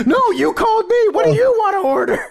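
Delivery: high laughing yell in a mock accent; imitating yelling. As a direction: shout it while cracking up.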